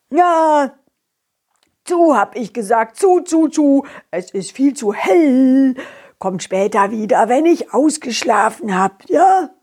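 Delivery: sleepy, grumpy mole voice; grouchy creature just woken. Acted. Mole repeats shut the lid.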